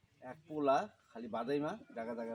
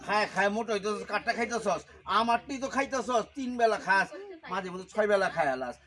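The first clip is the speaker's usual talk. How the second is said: loud, punchy tirade; angry scolding; 6 dB louder than usual. Acted. Husband scolding wife over how much she eats, angry and loud. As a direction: berate with rising volume.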